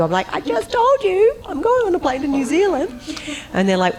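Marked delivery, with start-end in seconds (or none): 0.3-2.9 exasperated. Retold or imitated. Snapping back at panicking producers, high and exasperated. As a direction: spell it out, mock exasperated.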